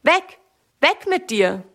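sharp high cry that drops back on the last words; child chasing a bird off, rising shout; shouting away a bird. A narrator's reading. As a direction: shoo the bird off the strawberries, high and urgent. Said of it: "ruft laut".

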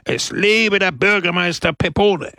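high shout of acclaim; roared long-live chant; crowd cheer. A narrator's reading. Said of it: "brüllte die Menge".

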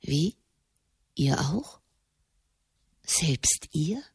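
a soft, breathless whisper of disbelief; hushed whisper. Acted. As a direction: whisper it, stunned, as if afraid to be overheard. Marconi asks in hushed awe.

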